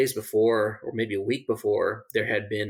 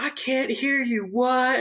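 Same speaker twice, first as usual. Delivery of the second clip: yelling; 14 semitones higher, slower than usual. Retold or imitated. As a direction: shout it, not hearing a thing. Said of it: "started yelling and yelling".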